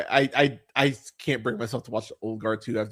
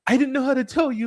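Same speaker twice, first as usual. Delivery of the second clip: higher and fast, a sheepish mock excuse; mock guilty friend; 11 semitones higher, faster than usual. Spy friend excusing herself to her best friend, mock whiny. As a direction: whine it like a guilty friend caught out. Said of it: "where it's like".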